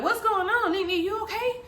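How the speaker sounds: high-pitched worried questioning; anxious, high rising questions; concerned brother. Retold or imitated. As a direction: imitate a brother checking on you.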